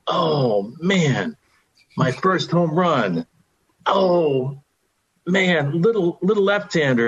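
lightly voiced old ballplayer thinking back; wistful musing. Aaron musing over first homer.

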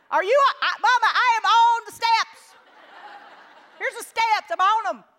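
put-on squeaky kid protesting she's fine; child voice. Child insisting in the pool.

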